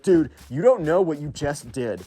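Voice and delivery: slow, put-on tough teen voice; mock tough emo kid. Emo kid threatens Hank, slow mock menace. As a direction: play the kid trying to sound menacing.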